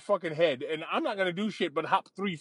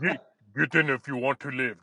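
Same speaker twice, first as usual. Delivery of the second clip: mock Austrian accent, lower, Terminator style; 6 semitones lower than usual. Girlfriend in a dune buggy, mock Austrian accent. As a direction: growl it out like an Austrian action hero. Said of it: "an Austrian accent".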